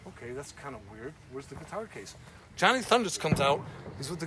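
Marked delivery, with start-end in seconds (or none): 0.1-2.2 much softer, puzzled aside under his breath; puzzled inner thought. Retold or imitated. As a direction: voice it quietly to yourself, like a thought you'd never say aloud. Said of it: "I'm looking at it like".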